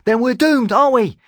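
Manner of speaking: very high, loud exasperated outburst. Acted. Talon exasperated with the group's options. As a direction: throw it out in frustration. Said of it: "in exasperation".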